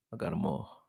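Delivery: soft unvoiced "I got 'em all"; whispered. Real, not acted. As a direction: breathe the line to yourself. Repeats phrase, then whispers it.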